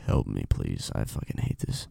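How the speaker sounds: very low, hushed mock plea; pleading deep-voiced man. Him despairing at the fans, deep mock plea. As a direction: sink deep and beg under your breath. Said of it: "you know what I can hear him saying?".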